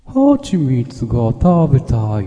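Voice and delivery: drawn-out honey-loving bear voice; Winnie the Pooh voice. Acted. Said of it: "プーさん".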